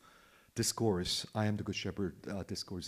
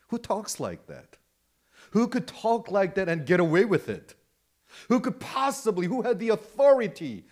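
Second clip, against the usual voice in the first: high, loud rhetorical questions; fired-up disbelief; incredulous exclamation; 8 semitones higher, 4 dB louder than usual. Real, not acted. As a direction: preach it in astonishment, pitch way up. Preacher marvels at Jesus's audacity.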